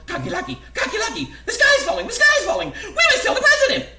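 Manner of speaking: very high, loud bird shrieks; panicked yell. A narrator's reading. Frantic birds alerting their friend Goosey Lucy, high shrieking yell. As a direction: squawk the alarm at full pitch. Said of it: "ran to the friend Cocky Lucky and yelled".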